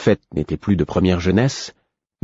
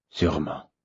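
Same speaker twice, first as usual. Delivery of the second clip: weary; 3 semitones lower, 8 dB quieter than usual. A narrator's reading. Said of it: "répondit-il d'un air las".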